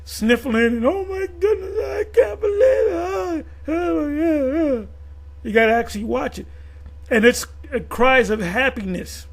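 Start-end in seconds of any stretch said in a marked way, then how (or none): 0.9-4.9 high, loud mock sob of joy; hammed-up weepy cry, pitched way up. Quoting the reporter who cries at the eclipse, mock sobbing. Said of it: "She's crying and sniffling".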